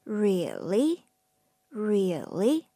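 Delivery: Really? dipping low then rising, doubtful; teacher comparing the third tone to a doubtful, dipping Really?; dipping question. Shown as a demonstration. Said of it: "similar to when you say".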